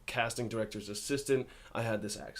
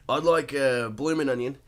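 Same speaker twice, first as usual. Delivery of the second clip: Australian accent, a touch louder; Ethan Lovett; 5 dB louder than usual. Shown as a demonstration. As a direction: put on a playful Aussie accent. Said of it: "in an Ethan Lovett voice".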